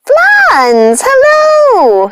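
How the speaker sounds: high, loud excited cry of hello; a sister shrieking hello at her cat; screamed greeting. Acted. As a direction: call out to the cat from down the path with joy. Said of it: "screamed Martha".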